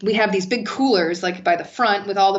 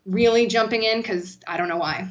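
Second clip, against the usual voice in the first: normal talking voice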